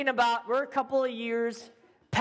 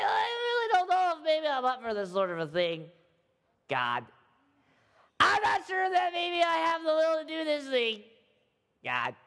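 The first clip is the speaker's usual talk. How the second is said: nervous whiny Gideon. Retold or imitated. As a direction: whine it out like a frightened young man. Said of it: "He talked just like this".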